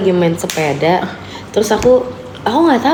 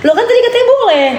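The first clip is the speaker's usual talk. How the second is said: put-on retelling her shocked reply to the boyfriend; baffled protest; 14 semitones higher than usual. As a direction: squeal that he'd said yes.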